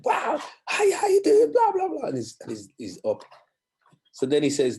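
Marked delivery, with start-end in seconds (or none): 0.0-2.1 high, loud excited gush. Retold or imitated. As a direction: gush on the phone like a thrilled fan. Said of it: "our Michael's all excited".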